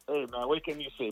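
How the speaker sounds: usual speaking voice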